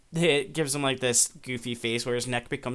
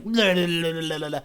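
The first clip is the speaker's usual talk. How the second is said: mock ghostly babble; 3 semitones higher, faster than usual. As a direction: babble la-la-la to spook someone playfully. Imitates spirit's scary face.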